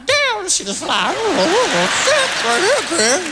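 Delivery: high voice of the surprised dog. Retold or imitated. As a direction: voice the stunned dog. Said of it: "The dog was even surprised".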